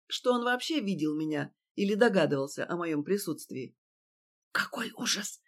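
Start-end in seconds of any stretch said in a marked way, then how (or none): 4.5-5.4 breathy whispered despair. A narrator's reading. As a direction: whisper it in dismay. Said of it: "прошептал Фатьянов".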